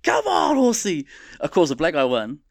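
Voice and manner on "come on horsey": cheering on a racer. Retold or imitated. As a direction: urge the horse home, squealing high. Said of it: "I was like".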